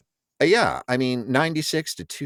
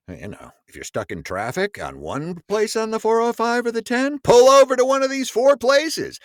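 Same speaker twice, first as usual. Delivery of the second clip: excited; 12 semitones higher, 4 dB louder than usual. Acted. Ad read building up.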